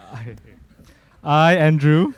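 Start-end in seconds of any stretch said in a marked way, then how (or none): 1.2-2.1 suddenly loud, declaring his name; loud and firm. Real, not acted. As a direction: belt it out with resolve. Groom restarts vows firmly.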